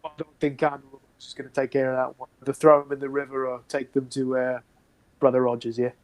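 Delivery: a hint of a gruff guard; second guardsman. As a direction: brush the question off. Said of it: "another kinda response".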